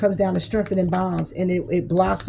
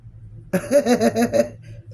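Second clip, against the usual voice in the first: laugh; 6 semitones higher than usual. Real, not acted. Laughs at own line.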